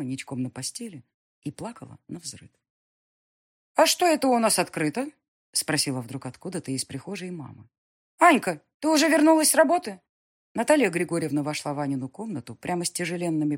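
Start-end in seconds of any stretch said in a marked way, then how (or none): 3.8-5.1 higher, louder calling from hallway; surprised mother calling. Acted. 8.2-10.0 higher, louder call to daughter. Acted. Mother calling out.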